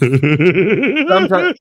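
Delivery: laughing through the word. Real, not acted. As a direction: breaks into a laugh mid-word. Joking about doll demons.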